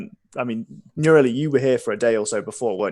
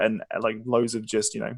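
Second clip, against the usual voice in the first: ordinary delivery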